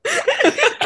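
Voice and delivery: high shrieking laugh; real helpless laughter; burst of laughter. Real, not acted. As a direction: let the laugh break out high. Host cracks up laughing.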